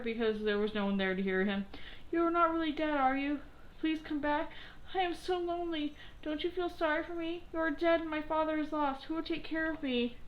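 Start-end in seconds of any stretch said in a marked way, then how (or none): 2.1-10.2 put-on high, pleading boy's voice over the grave; grieving puppet boy. A narrator's reading. Pinocchio begging the dead fairy at her grave, high and pleading. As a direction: beg the dead fairy to come back, lonely.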